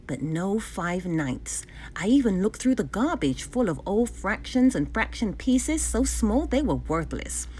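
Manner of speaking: plain reading voice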